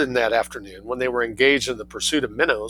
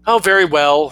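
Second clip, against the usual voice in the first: put-on louder, grudging boy; sulky; 5 semitones higher, 7 dB louder than usual. Boy giving in to his mother, sulky and grudging. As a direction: give in with a sulk. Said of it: "said Victor sulkily".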